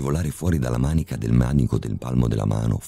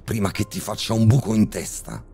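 raised, threatening father's voice through clenched teeth; through gritted teeth; 9 semitones higher than usual. A narrator's reading. Father holding a gun on the doctor, menacing through gritted teeth. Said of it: "disse mio padre a denti stretti".

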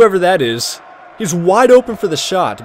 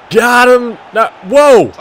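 higher, louder triumphant call; excited; 5 semitones higher, 6 dB louder, slower than usual. Real, not acted. Gamer celebrating a won tackle, loud and pleased. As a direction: cheer as the tackle lands.

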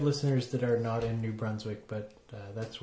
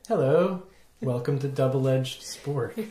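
announcer voice; 4 dB louder, slower than usual. Acted. Podcast intro, show voice.

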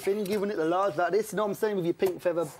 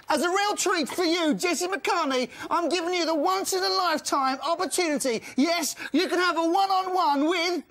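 grand announcer; 10 semitones higher than usual. Acted. Host offering the guest a once-in-a-lifetime treat, grand high announcer voice. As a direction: announce yourself like a megastar prize. Hammed-up presenter announcing a prize.

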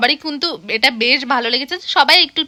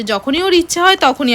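usual speaking voice

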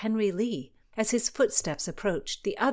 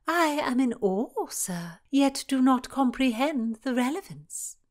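arch princess; 7 semitones higher, 4 dB louder than usual. Acted. Cecilia arch reply.

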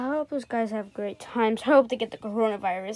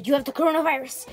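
ordinary talk

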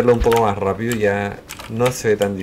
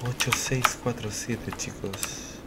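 whispering; 9 dB quieter, slower than usual. Real, not acted. Whispering the code while sneaking.

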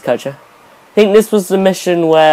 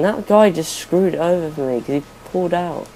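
ordinary talk, nothing marked

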